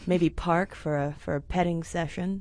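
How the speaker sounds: a little creepy. Real, not acted. Podcaster suggesting a parked-car petting session, slightly creepy. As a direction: let it sound slightly creepy. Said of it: "I said that kinda creepily".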